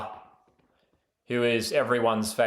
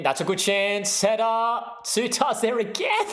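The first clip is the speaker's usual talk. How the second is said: builds higher and higher as the header comes in; rising excitement; 9 semitones higher than usual. Real, not acted. Fan calling a late header on stream, rising excitement. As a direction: climb in pitch with each line, the chance getting closer. Live call of a Turkey chance.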